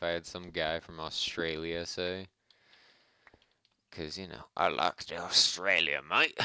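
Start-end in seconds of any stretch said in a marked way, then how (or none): 4.6-6.4 put-on a light, not very accurate Australian accent; playful Australian accent, mate at the end. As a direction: toss off a quick Australian mate. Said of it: "I like to do an Australian accent".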